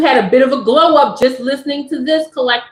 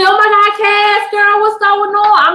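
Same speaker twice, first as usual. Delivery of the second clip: excited fan greeting; 8 semitones higher than usual. Pretend.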